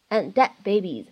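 "And dead babies" with both final d sounds held back; don't release the d of "And" or "dead" in "And dead babies"; loss of plosion. Shown as a demonstration. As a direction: run "And dead babies" together, d's swallowed. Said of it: "存在两处失去爆破的技巧".

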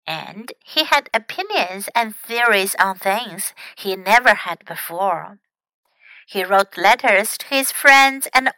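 emphatic, clearly projected storytelling; story narration. Acted. Teacher reads of the grandfather's progress, projected story narration. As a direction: read it out with lively emphasis.

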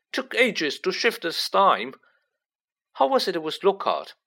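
high-pitched aggrieved grumble; weary, complaining Ron. Acted. Figure of Ron grumbling in story.

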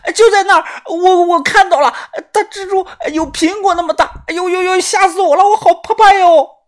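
very high, loud falsetto panic; hammed-up hysterical shrieking; loud, shaken terror. A narrator's reading. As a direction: squeal it out, stammering with fright. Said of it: "惊魂不定地指着草堆".

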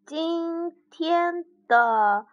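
slow, halting bear greeting. Acted. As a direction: drag every word out. Bear's first words.

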